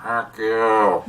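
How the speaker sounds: drawn-out imitation of a bird; talking bird. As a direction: mimic a bird talking back, stretching the words. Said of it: "what he taught the bird".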